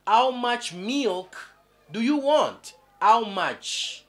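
slow, stressed how much; teacher modelling how much; teacher's model question. Shown as a demonstration. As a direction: model the English question slowly, hitting how.

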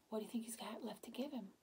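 plain reading voice

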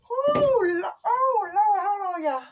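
sudden high shout of alarm; a loud "Lord" of panic; startled shout. Real, not acted. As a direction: yell out as the pole slips. About to lose the pole.

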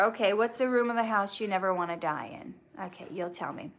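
normal talking voice

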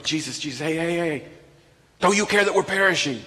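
desperate disciples shouting at sleeping Jesus; frantic plea. Acted. Disciples shake Jesus awake.

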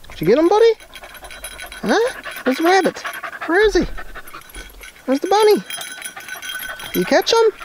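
very high excited dog talk; squeaky praising a dog. Real, not acted. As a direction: ask the dog eagerly, way up high. Excited questions to the hunting dog.

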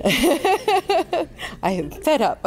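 slow, laughing mock exasperation; playful fake complaint between laughs; mock fed up. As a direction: drag it out through a laugh, pretending to be fed up. Host jokes about shouting guests.

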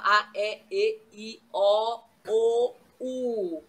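each vowel sounded separately; measured run through the vowels; vowel demonstration. Shown as a demonstration. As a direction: spell out the open and closed vowels. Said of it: "E eu posso falar".

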